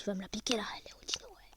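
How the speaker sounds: low secret whisper; whispered aside. Real, not acted. Gamer whispering about the little thief, hushed. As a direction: whisper so the thief won't hear.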